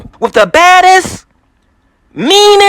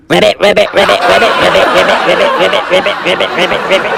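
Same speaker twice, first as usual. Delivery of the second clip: frog croaks; 10 semitones lower, faster than usual. Acted. Hopping like a frog.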